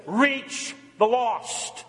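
drawn-out slow, each word leaned on; preacher slowing down for the congregation to repeat; slow call to repeat. Shown as a demonstration. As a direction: lead the room, say it slowly so they echo. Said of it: "Say it".

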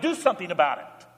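ordinary talk, nothing marked

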